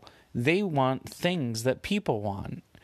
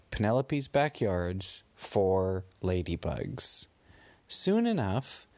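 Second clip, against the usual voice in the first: ordinary delivery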